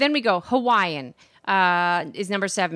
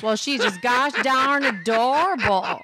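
lightly put-on Texas accent; 2 semitones higher than usual. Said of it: "she's from Texas".

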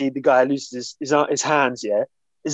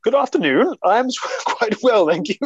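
put-on English accent, pitched up; 7 semitones higher, 4 dB louder than usual. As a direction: play up an English accent for Americans. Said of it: "That's my first English vo-, voice for all the Americans".

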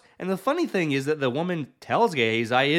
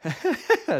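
amused chuckle; 13 semitones higher than usual. Real, not acted. Preacher laughs at his joke.

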